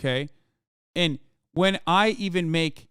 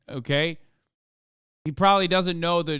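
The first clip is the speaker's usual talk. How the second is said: ordinary talk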